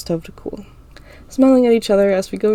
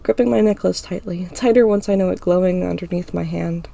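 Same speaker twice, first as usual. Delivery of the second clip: usual speaking voice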